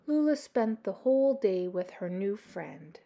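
ordinary delivery, nothing marked

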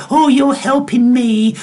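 lightly mocking imitation of admirers; mimicked gushing. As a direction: parrot the grateful follower in a high voice. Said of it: "then people go".

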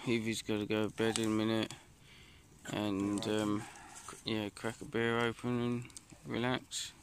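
ordinary delivery

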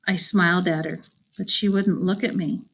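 plain reading voice

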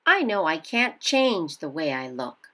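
usual speaking voice

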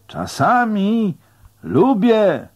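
high, stretched-out holler; shouting back. A narrator's reading. Rower answering the man on shore, shouting back. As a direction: answer loudly over a long distance. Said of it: "odkrzykłem temu na brzegu".

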